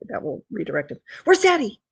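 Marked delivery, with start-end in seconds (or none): 1.2-1.8 high, loud dog-teasing voice; excited pet talk. Real, not acted. Owner redirecting the dog to look for Daddy, excited. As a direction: squeal it eagerly to get the dog searching.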